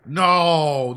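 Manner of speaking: loud, panicked cry of no; alarmed shout. Real, not acted. Streamer yelling at the game in alarm. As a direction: shout it in real dread. Dreading Undyne chasing him.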